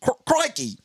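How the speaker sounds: high-pitched Australian catchphrase; Australian accent. Acted. Comic warming up for a wildlife host impression, high Australian accent. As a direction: launch into a broad Australian accent. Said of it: "you gotta start off with crikey".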